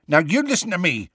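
high, fast angry warning. A narrator's reading. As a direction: bark back at them. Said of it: "said Hagrid angrily".

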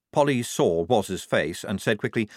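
usual speaking voice

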